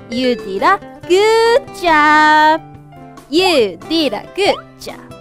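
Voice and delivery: ordinary talk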